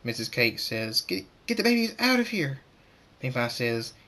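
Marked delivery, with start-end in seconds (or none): 1.0-2.6 high-pitched, stammered alarmed command; urgent order. Acted. Missus Cake tells Pink Pie to save babies, urgent high order.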